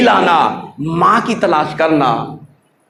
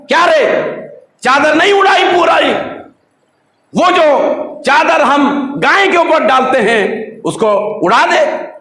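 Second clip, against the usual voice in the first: father scolding; 7 semitones higher than usual. Retold or imitated. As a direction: snap at the boy to cover the old man. Father scolding son loudly.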